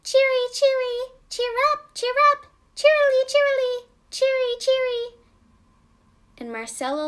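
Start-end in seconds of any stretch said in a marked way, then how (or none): 0.0-5.2 very high, loud chirpy birdcall; Daddy Robin's call. Acted. Daddy Robin calling out to help find Raggedy Ann, high loud birdsong. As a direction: trill each cheery out like a robin. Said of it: "And Daddy Robin started calling".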